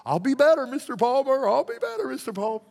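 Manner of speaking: put-on high-pitched eager child voice; little boy. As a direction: promise it like a small boy, voice way up high. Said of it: "walking out of the office going".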